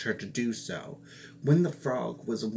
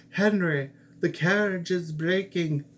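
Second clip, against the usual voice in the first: higher, louder call to Henry; cry of alarm; 7 semitones higher, 6 dB louder than usual. A narrator's reading. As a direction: shout back over the noise of the carriage. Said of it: "He turned around and cried".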